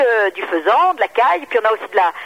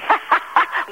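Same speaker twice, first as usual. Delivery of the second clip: breathy giggle; 9 semitones higher than usual. Real, not acted. Prank question makes her giggle.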